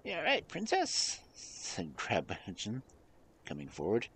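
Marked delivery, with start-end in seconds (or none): 0.0-1.2 pet monkey. Acted.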